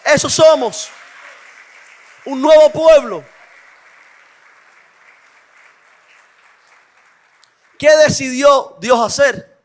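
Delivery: loud, rising sermon proclamation; fiery preaching. Acted. Preacher proclaiming to the church, loud and fervent. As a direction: proclaim it to the congregation. Opening proclamation at full force.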